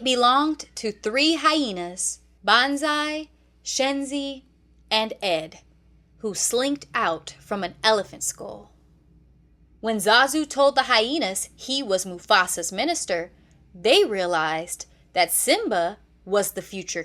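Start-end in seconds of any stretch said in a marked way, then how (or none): none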